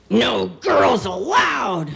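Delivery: slow, drawn-out taunting shout; slow boyish chant of refusal; bossy brother's refusal. Acted. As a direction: shut out your little sister, word by word. Brothers refusing little sister.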